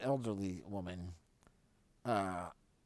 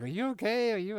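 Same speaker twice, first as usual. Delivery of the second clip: high, louder worried check; worried mom; 11 semitones higher, 7 dB louder than usual. Retold or imitated. Mom checking on her son after the crash, high worried. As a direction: ask anxiously, pitched up. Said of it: "she was like".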